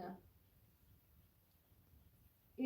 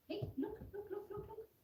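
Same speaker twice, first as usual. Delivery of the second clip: higher, soft repeated looks; sing-song look, look to a pet; high coaxing to a puppy; 9 semitones higher, 5 dB quieter, faster than usual. Real, not acted. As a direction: coax the puppy to look, lightly. Calling the puppy's attention.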